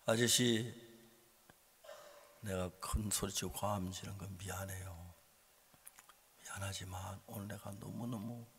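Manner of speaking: subdued, conciliatory retold plea; calm apology. Retold apology to bus driver.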